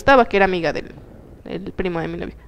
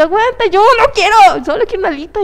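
exasperated refusal; 9 semitones higher, 4 dB louder than usual. Retold or imitated. Refusing pushy guy.

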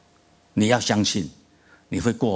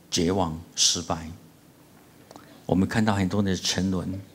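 earnest, measured prayer voice; closing prayer. Acted. Pastor leading the church in prayer, earnest and measured.

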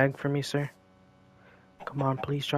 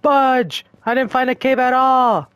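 high, loud frustrated yell; frustrated shout; 10 semitones higher, 9 dB louder than usual. Real, not acted. Player finds no cave and yells, high and loud, in frustration.

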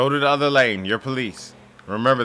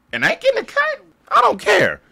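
very high, squeaky mocking voice; mock the NPC in falsetto; 5 dB louder than usual. Gamer mocking a greedy NPC in falsetto.

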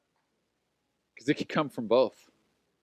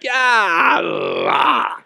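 sheepish yeah; 6 semitones higher, 5 dB louder than usual. Retold or imitated. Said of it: "And I'm just like".

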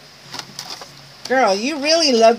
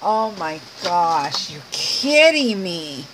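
ordinary talk

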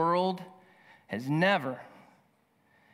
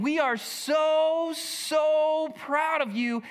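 much higher, very slow, drawn-out pride; overjoyed parents; 9 semitones higher, much slower than usual. Retold or imitated. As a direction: stretch each word out, bursting with pride. Parents voiced cheering their baby.

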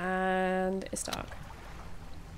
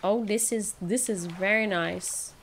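ordinary talk

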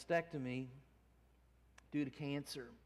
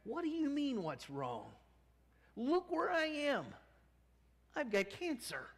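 high, louder bitter retort; spat back; 13 semitones higher, 4 dB louder than usual. Retold or imitated. Crying woman snapping at Miss Miller, bitter and loud. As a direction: snap it back at her. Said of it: "the woman spat back".

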